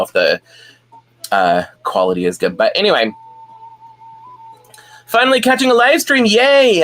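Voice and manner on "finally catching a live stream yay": excited. Real, not acted. Greeting a viewer joining.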